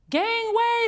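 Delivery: high loud call to clear the way; urgent shout. A narrator's reading. Amelia Bedelia rushing in with a blower, shouting. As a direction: shout to get people aside. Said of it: "said Amelia Bedelia".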